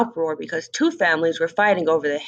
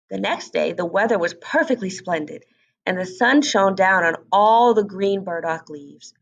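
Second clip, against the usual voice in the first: ordinary delivery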